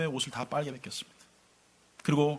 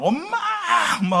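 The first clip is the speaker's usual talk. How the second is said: mock scream, pitched way up; retold child's scream; 5 dB louder than usual. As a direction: imitate a terrified child calling out. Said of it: "소리를 지르는 거예요".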